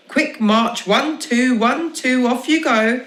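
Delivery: mock drill sergeant calling the beat; sergeant major. Dad mock drills the children into chores. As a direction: bark it like a cheery parade ground.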